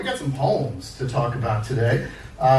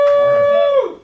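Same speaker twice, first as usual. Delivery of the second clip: high, loud call to settle the room; loud emcee call; 7 dB louder than usual. Real, not acted. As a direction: grab the room's attention. Calls the room to attention.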